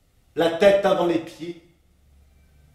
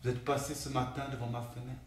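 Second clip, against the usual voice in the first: very soft, low recital; hushed grief; 4 semitones lower, 13 dB quieter, faster than usual. Acted. Colleague remembering someone passing the window, hushed and grave. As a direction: say it low, barely voiced. Refrain returns as death is revealed.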